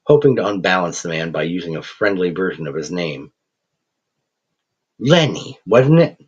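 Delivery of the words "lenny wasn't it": Lenny tossed out high and sneering; mocking taunt. Thug taunting the professor with a nickname, sneering. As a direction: needle him with the nickname.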